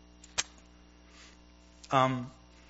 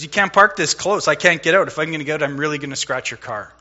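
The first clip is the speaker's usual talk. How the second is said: stern guard scolding; 2 semitones higher, 9 dB louder than usual. Retold or imitated. Border guard telling the driver he parked too close, mock stern and loud. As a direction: bark it out like an annoyed official.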